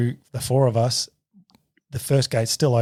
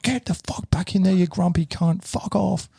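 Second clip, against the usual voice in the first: much higher re-enacted outburst, no full shout; retold angry dismissal; 8 semitones higher than usual. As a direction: send him packing, replaying the row. Climax of acted quarrel.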